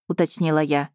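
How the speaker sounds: ordinary delivery, nothing marked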